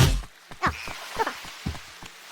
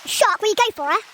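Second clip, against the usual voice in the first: ordinary delivery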